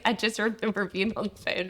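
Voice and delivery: ordinary talk, nothing marked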